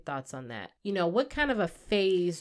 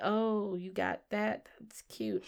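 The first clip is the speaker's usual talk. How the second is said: unimpressed; 10 dB quieter, slower than usual. Retold or imitated. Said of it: "you look at it like".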